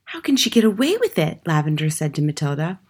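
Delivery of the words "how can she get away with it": put-on higher-pitched, incredulous child's question; indignant question. A narrator's reading. Girl asks her friend about the headmistress, indignant. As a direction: ask it in disbelief, pitched up.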